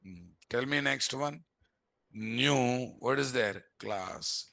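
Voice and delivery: ordinary delivery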